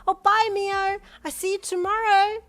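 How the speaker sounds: put-on high, loud child voice; little girl calling. A narrator's reading. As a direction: holler goodbye to your preschool friend. Little girl calling back to friend.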